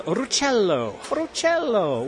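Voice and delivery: slow, high, drawn-out flourish; grand announcement. Pretend. Presenter introducing the rhubarb drink man, grand announcement. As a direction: stretch the name out theatrically, pitch soaring.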